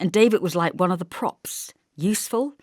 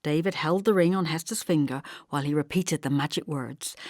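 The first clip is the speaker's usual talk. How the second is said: plain reading voice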